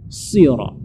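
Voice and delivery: "sirat" with a heavy, long S; dark emphatic S in "sirat". Shown as a demonstration. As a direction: lift the back of the tongue for "sirat". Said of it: "yang diangkat".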